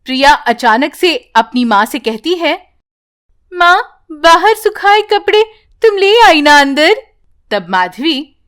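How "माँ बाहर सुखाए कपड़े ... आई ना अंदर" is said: put-on higher-pitched child asking her mother; a small daughter checking on the laundry; little girl's voice. Child voice in story.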